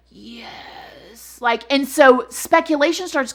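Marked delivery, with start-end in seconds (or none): 0.1-1.4 put-on breathless whispered yes of a feeble old man; frail old man. Killer answering the judge, faked frail whisper. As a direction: barely breathe the word out. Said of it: "And he's like".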